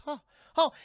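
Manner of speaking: short imitated gasps of awe; amazed gasp. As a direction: gasp in wonder, acting it out. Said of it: "taking all in amazement".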